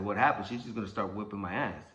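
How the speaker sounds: usual speaking voice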